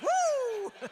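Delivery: very high, loud whoop; re-enacted whoop. Her reply to the fireman's promise, re-enacted high whoop. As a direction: let out a gleeful high woo. Her reaction to his shout.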